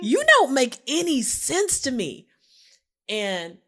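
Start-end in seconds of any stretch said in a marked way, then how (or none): 0.0-2.3 higher-pitched, incredulous complaint; animated, disbelieving questioning; exasperated bafflement. Retold or imitated. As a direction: confront a partner who baffles you. Acting out her bewilderment at him.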